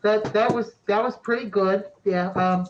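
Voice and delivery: high, loud statue spirit; high-pitched, eager character voice; distant echoey voice. Acted. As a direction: call out high, as if from far off. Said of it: "that distant, echoey voice".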